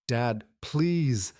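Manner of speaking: high, pleading, a touch of sobbing; a lightly voiced tearful daughter; sobbing plea. A narrator's reading. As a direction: beg Dad through mock tears. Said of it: "she sobbed".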